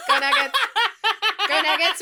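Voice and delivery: gleeful sports cheer through laughter; excited fan chant; laughing cheer. Real, not acted.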